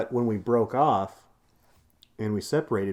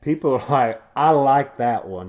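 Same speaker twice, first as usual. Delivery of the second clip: hammed-up Southern twang, loud; Southern accent; 4 semitones higher, 9 dB louder than usual. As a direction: draw it out in a big Southern accent. Both revel in put-on accent.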